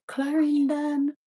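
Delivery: slow, high-pitched place name; high drawn-out answer. Retold or imitated. Answering where in Jamaica, high and drawn out. Naming her parish to the doubter.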